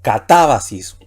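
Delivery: "catábasis" with a firm stress on "tá"; ca-TÁ-ba-sis, a demonstrated reading of "catábasis"; stress on antepenultimate syllable. Shown as a demonstration. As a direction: hit "TÁ" in "catábasis" and let the ending fall. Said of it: "la palabra aquí sería".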